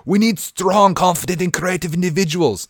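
hammed-up Russian accent. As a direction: read it like a stern Russian commander. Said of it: "I picture this in, like, uh, a Russian accent".

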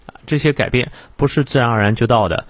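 ordinary delivery

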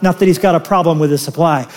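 usual speaking voice

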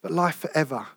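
soft, low, tender invitation. Real, not acted. Preacher gently inviting visitors to come to God, softly. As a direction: speak gently as if inviting someone home. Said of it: "with all tenderness in my heart".

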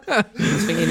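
laughing. Real, not acted. Group laughing at the mix-up.

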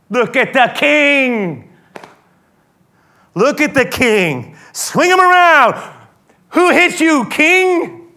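a loud, jeering taunt, high and drawn out; mocking soldiers. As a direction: soldiers sneer at the beaten prisoner, loudly mocking. Soldiers mocking Jesus as king.

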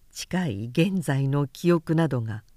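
plain reading voice

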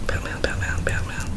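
thinking aloud in a hushed murmur; musing whisper. Real, not acted. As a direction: whisper Batman to yourself. Thinking aloud under his breath.

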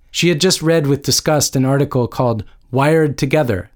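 usual speaking voice

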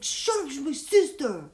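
mock brother yell. Imitating her brother telling his friends off, loud yell. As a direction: bark it like an annoyed big brother.

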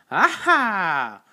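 sudden high, loud triumphant cry; high-pitched holler. Acted. Sanchez surprising the group with a holler. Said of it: "Sanchez's high-pitched holler".

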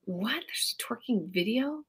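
surprised whisper. Real, not acted. Co-host hearing about the twerking video, curious whisper. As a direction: breathe it in surprise.

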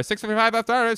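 very high, loud naval yell; hammed-up captain screaming an order; frantic movie impression. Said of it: "here's my impression of".

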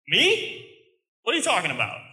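high-pitched, disbelieving "who, me?"; startled Gideon. Acted. Terrified Gideon answering the angel of the Lord, startled and incredulous. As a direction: squeak the first word, then drop in disbelief. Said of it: "I picture this, this kind of motion".

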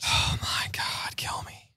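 slow, exasperated whisper; despairing whisper. Real, not acted. Cohost groaning at host's crude joke, slow whisper. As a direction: whisper it like you're begging to be put out of your misery.

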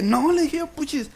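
mock protest, pitched well up; high-pitched protest. Victim refusing the shouting robber, high retold protest.

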